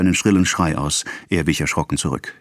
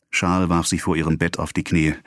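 plain reading voice

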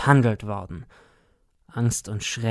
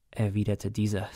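usual speaking voice

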